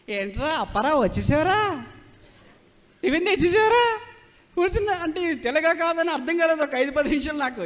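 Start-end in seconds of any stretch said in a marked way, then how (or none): 0.1-4.0 high, sing-song mock Visakhapatnam accent; Visakhapatnam dialect impression. Preacher mimicking train passengers from Visakhapatnam, sing-song dialect. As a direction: mimic Visakhapatnam chatter, swooping up loudly at the end. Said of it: "అంత కొత్త కొత్తగా ఉంది భాష".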